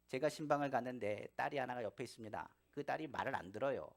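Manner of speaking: ordinary delivery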